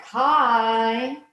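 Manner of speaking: loud distant hi called across a room; far-off hi. Shown as a demonstration. Distant colleague greeting from far away, a loud called hi. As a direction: call hi from way over there. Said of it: "talking to you from way over here".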